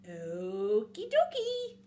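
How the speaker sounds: slow, stretched-out reply; falsely agreeable snake. Acted. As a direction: draw it out slyly.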